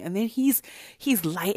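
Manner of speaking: ordinary talk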